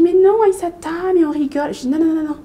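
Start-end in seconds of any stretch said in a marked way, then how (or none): 0.0-1.7 high, louder mimicked backpedalling; imitated protest. Classmate says it was only a joke, mimicked protest. 1.9-2.4 high, insistent retold no-no-no; acted refusal. Schoolgirl rejects the joke excuse, acted insistence.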